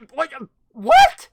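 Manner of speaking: high loud disbelieving what; shocked. Real, not acted. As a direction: yelp it in disbelief. Shocked at chat.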